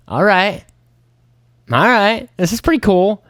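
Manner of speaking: sudden high, delighted all right; pleasantly surprised. Retold or imitated. Fisherman thrilled by his catch, high and excited. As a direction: perk up, thrilled by the first catch.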